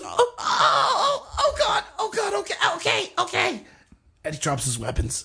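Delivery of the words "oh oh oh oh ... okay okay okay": very high, shrieking, terrified surrender; panicked goblin squeal. Acted. GM voicing the goblin as it begs the party. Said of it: "He goes".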